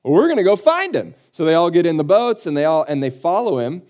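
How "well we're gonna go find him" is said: loud, high burst of resolve; mock-eager group setting off; eager crowd. Crowd pulling up in ships, eagerly resolved.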